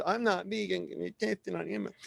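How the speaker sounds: high-pitched mock vegan; vegan critic. Vegan out there objecting to the speaker, mock complaint. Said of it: "according to some vegan out there".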